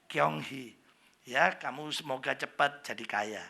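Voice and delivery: low, soft Hokkien well-wisher; softly spoken, low-pitched greeting; low Hokkien intonation. Shown as a demonstration. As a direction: keep the blessing low and subdued. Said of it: "Ya rendah".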